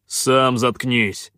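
loud, slow, heavy snap back; barked retort. A narrator's reading. Khan silences the pirate, angry bark. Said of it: "рявкнул Хан".

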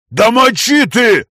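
slow, loud booming roar; booming amplified voice. Acted. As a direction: thunder it out like a giant. Stone golem Vasya shouting.

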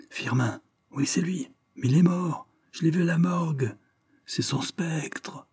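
high, soft frightened whisper fading to breath. A narrator's reading. Terrified guests whisper about the ghost, hushed and high. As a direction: hush it out in terror. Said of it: "chuchotés".

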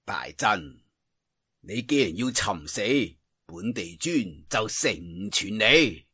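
low, soft cold threat; a quiet, chilling threat; quietly angry. A narrator's reading. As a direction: menace him in a low, soft voice. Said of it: "心中微怒， 面色一沉话".

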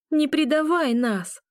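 slow, high pleading scream; desperate shout. A narrator's reading. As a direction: scream with all your might. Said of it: "кричу я изо всех сил".

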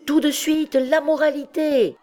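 high, insistent chanted demand; crowd demanding. A narrator's reading. Crowd demanding the play at once, high insistent call. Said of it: "répétait la foule".

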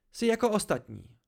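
high, indignant dismissal; angry accusation. A narrator's reading. Dena lumps the narrator with others, angry accusation. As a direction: dismiss them hotly.

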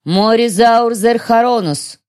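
slow, high cold incantation; dark elf voice. Acted. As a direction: cast a deadly dark spell. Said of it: "голос тёмной эльфийки". (голос тёмной эльфийки)

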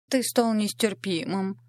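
slow, whiny pouting complaint; whiny spoiled pouty drawl. A narrator's reading. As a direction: drag it out like a spoiled beauty. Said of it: "закапризничала красотка".

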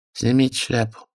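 flat, bored clerk reading; colorless official voice. A narrator's reading. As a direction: drone it without looking up. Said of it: "произнёс бесцветным голосом чиновник".

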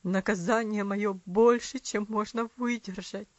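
low, strained and choked; father chokes on his guilt. A narrator's reading. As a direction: force it out through a tight throat. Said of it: "воскликнул он сдавленным голосом".

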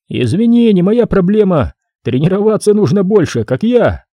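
a proud worker bragging; cocky worker. Acted. Mike voiced.

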